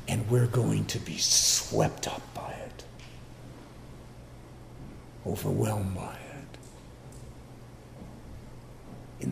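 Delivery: slow, soft, hushed words; awed whisper. Real, not acted. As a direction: breathe it out in wonder. Awed hush about God's presence.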